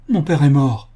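anguished cry. A narrator's reading. Daughter realising her father is dead, loud anguished cry. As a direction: blurt out the horror. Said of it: "s'écria-t-elle".

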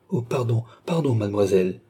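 usual speaking voice

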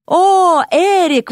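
loud, high-pitched delighted greeting; light up at seeing a welcome arrival; excited call, voice leaping up; enthusiastic. A narrator's reading. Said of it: "воодушевился Ипполит".

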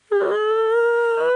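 long high-pitched scream; thin sustained wail of terror; terrified scream. A narrator's reading. Худяков hears he must kill Пётр, high drawn-out scream. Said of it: "заорал Худяков".

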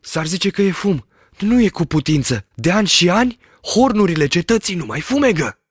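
high, alarmed, disbelieving; fear and surprise. A narrator's reading. Shepherd sees smoke from the castle, afraid and surprised. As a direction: voice shaking with dread. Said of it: "o voce în care groaza se împletea cu surprinderea".

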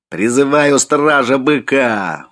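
slow, high, loud summons; full-voiced shout. A narrator's reading. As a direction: call out with all his voice. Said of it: "во весь голос закричал он".

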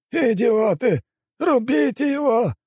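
very high, strained, drawn-out shout; frantic angry screaming; elder's furious yelling. A narrator's reading. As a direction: bellow orders at useless underlings. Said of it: "орал старейшина".

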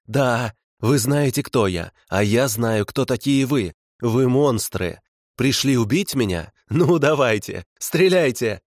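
сначала медленно и веско, потом срываясь на высокий крик; дразнит врагов, нарастая до крика; вызов с нарастанием. Acted. Фанатик вызывает стрелять. (от медленного вызова к крику «стреляйте»)

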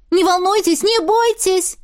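shouting over everyone. A narrator's reading. Heroine shouts over the panic to calm everyone. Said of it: "я постаралась перекричать всех".

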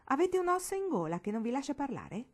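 a booming teasing uncle, pitched high and loud; ringing uncle voice. A narrator's reading. Uncle teasing Marigold at dinner, ringing loudly. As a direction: tease the silent girl across the table. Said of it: "la voce di zio Paul risuonò".

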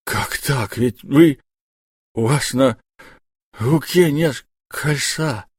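a touch of trembling shock, the last words slow; stunned husband-fear, words dragging out; husband in shock at the news, mildly shaken and slow; shaken disbelief. A narrator's reading. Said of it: "его трясло мелкой дрожью".

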